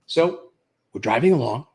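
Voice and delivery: ordinary talk, nothing marked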